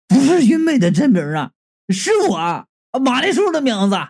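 dismayed realisation. A narrator's reading. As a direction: wail it in mock horror at the discovery. Narrator's horrified discovery, acted. (惊慌哀嚎般的发现)

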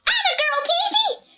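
put-on very high-pitched excited children's shriek; shrieked cheer. A narrator's reading. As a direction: cheer her on in a piercing squeal. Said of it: "shrieked Timmy and Anika, so loudly".

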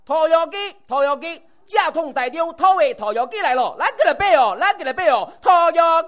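Taiwanese hawker's cry. Retold or imitated. Imitating a Taiwan roast chicken seller, loud and high. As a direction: sell it like a movie trailer voiceover. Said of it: "这是个电影配音演员吗？".

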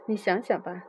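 plain reading voice

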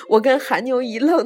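usual speaking voice

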